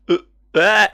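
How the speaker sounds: short grunt into a loud, high yell; hammed-up burst of pain; acted pained cries. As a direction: cry out in mock agony. Character in agony, burning.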